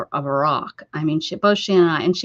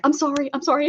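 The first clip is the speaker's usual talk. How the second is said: mock screamed apology; 7 semitones higher, faster than usual. Patient apologizing to the doctor during a painful shot, mock yelling. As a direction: imitate yelping apologies mid-injection. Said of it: "as I'm screaming and yelling".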